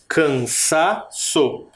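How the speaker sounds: slow Cansaço, syllables apart, SA brought out; syllables with strong SA. Shown as a demonstration. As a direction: say Cansaço slowly, leaning on SA. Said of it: "Qual que é a sílaba mais forte". (Cansaço separado, com o SA mais forte)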